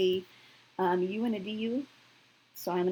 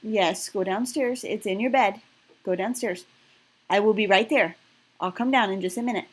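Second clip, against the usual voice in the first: firm raised voice; 8 dB louder than usual. Real, not acted. Mom insisting child go downstairs.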